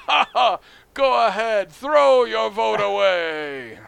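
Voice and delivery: high, loud mocking taunt; gloating alien. Alien jeering a third-party voter, loud drawn-out mockery. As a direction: laugh scornfully, then stretch out the taunt. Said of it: "And they go".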